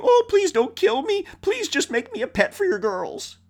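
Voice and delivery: high, whiny begging voice; mock pleading monster. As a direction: play the monster as a pitiful beggar. Leviathan imagined begging for mercy.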